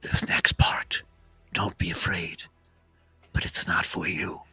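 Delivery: solemn, knowing spirit whispering a warning; whispering orb spirit. Acted. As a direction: breathe it like a secret only they can hear.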